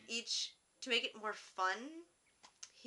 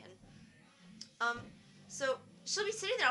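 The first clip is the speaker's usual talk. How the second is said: ordinary talk, nothing marked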